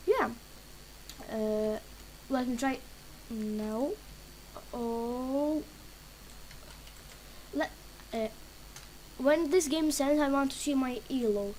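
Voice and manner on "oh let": usual speaking voice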